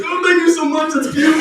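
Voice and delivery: high, loud, overcome with gratitude; dad melting at the vet's offer; gushing dad. Retold or imitated. Dad thanking the vet, high gushing.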